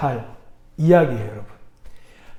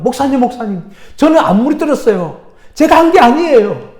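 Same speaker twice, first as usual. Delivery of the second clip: loud, higher-pitched panicked protest; flustered child; 6 semitones higher, 7 dB louder than usual. Acted. Startled boy denies the blame to the pastor, flustered and loud. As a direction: blurt out an alarmed, loud denial. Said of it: "이 아이가 참 당황했어요".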